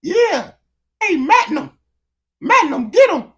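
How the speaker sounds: high, loud British yelling; squealed British-accented war cry; mock British attacker. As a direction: shout it as a hammed-up Brit. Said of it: "walked down by a British person".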